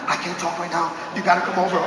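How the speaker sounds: mock-urgent quiet phone voice; secretive hush. Secretive call inviting a friend.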